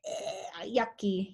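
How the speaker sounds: yucky face. Shown as a demonstration. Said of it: "Can you make a yucky face?".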